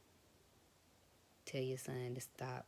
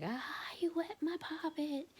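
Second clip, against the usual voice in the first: put-on much higher, louder, whining kid voice; whiny little boy; 4 dB louder, slower than usual. Son whining that mom got his toy wet, high kid whine. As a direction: whimper it like a crying toddler. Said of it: "And he was like".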